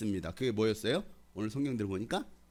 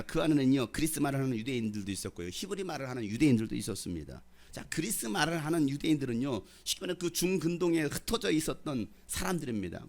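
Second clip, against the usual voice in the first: ordinary delivery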